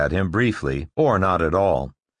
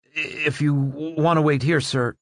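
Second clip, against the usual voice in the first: nervous murmur; 7 semitones higher than usual. Acted. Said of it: "One of the guards murmured".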